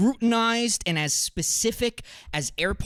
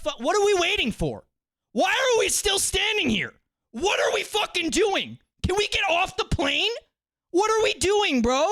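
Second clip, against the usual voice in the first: loud, high, fed-up yelling at the wait; bursting out in frustration; exasperated rant; 10 semitones higher, 3 dB louder than usual. Real, not acted. Ranting to chat about standing in the aisle, loud and fed up.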